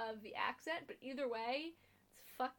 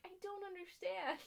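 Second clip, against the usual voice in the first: higher-pitched mock bewilderment; baffled Regina; 7 semitones higher, 4 dB quieter than usual. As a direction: lightly imitate someone who just doesn't get it. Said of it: "she's still like".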